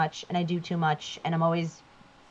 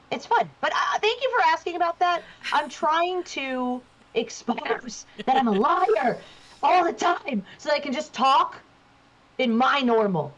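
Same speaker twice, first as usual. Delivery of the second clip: higher, quicker upbeat voice; walking around voice; 6 semitones higher than usual. Shown as a demonstration. Voice actor back in her higher walking around voice. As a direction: put the higher everyday voice back on. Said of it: "which is over here".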